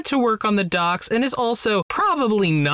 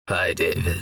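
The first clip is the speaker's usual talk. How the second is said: manly voice; 13 semitones lower than usual. Shown as a demonstration. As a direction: drop deep into a husky manly voice. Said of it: "that was, ooh, shiver down my spine".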